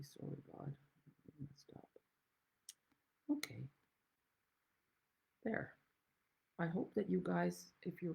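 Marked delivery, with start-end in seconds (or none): 0.0-1.9 hushed, voiceless apology to God; whispered prayer. Pretend. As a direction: whisper it like a humble confession. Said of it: "go back on your knees and say".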